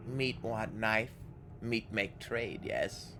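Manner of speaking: soft sly ghoul hiss. Acted. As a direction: whisper the deal slyly. Ghoul offers trade.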